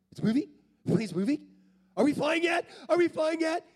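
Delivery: put-on loud, high-pitched eager kid; excited little boy. Boy pestering seatmate on first flight, excited kid. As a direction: escalate into a thrilled child's shrieky questions. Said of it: "there's this little boy".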